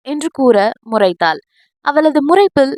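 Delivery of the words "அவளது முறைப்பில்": ordinary delivery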